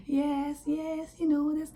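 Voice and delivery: soft, high, polite voice; nice and calm. Retold or imitated. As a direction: imitate keeping your voice down in a café. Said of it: "I was talking very nice and calm".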